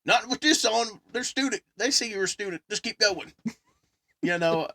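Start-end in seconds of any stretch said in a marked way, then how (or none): 0.0-3.3 imitated driver. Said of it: "He goes".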